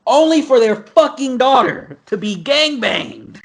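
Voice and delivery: high-pitched, drawn-out disbelief; incredulous outrage. Real, not acted. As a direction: hit it high and slow, appalled by the irony. Outraged at the irony of story.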